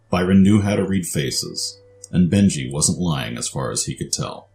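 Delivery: usual speaking voice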